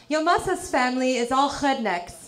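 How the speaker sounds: imitated European grandma accent; throaty H for R, European accent. European grandma insulting the family, foreign accent. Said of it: "for people who can't speak English real good".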